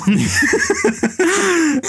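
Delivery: a high, cackling laugh at her own joke; high burst of laughter. Real, not acted. Critic cracking up at her bartender bit, high laugh. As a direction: break up laughing.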